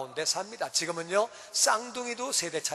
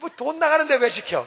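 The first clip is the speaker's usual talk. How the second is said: indignant parent; 7 semitones higher, 7 dB louder than usual. Retold or imitated. Parent grumbling about cost.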